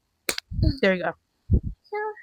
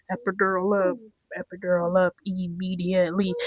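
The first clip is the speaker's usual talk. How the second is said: softer repeat; 6 dB quieter than usual. Retold or imitated.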